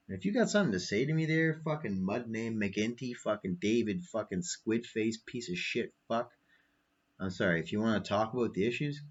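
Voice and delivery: usual speaking voice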